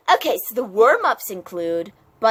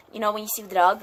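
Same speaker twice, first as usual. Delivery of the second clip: usual speaking voice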